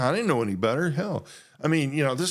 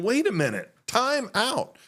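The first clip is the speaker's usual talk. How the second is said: higher-pitched mock protest; taken aback; 9 semitones higher than usual. Retelling his shock at the pastor's normal voice, taken aback. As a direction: call a sudden halt in disbelief. Retold startled reaction to pastor.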